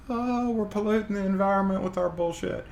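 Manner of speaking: a sarcastic mimic of preachy people; mocking voice. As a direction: parody the hand-wringing crowd. Mocking what everybody says.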